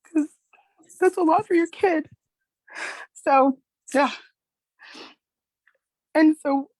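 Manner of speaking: fighting back tears as she speaks; emotional mother near tears; choked up. Real, not acted. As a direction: voice rising high with emotion, close to tears. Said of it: "It gets me emotional".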